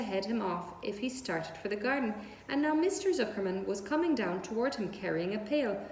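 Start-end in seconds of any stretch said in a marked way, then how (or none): none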